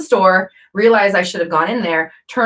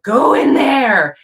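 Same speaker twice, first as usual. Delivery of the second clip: inner pull; 7 semitones higher, 5 dB louder, much slower than usual. Retold or imitated. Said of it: "It was like".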